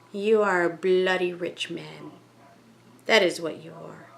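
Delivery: plain reading voice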